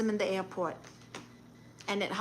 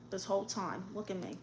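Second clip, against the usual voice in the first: very soft, lower aside; talking to oneself; 3 semitones lower, 12 dB quieter, faster than usual. Real, not acted. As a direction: say it low, half to yourself. Talking to self while checking screen.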